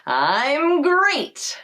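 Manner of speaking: hearty cartoon-tiger cheer; Tony the Tiger. Shown as a demonstration. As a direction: boom it like the cereal tiger. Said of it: "try Tony the Tiger".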